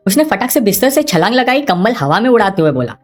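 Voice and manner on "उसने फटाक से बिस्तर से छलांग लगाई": ordinary delivery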